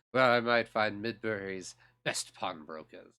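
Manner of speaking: lightly voiced dark elf. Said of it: "I keep forgetting to do the dark elf voice".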